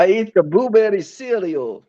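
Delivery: hammed-up Transylvanian accent; Count Dracula. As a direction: play a vampire count. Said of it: "has to talk like this".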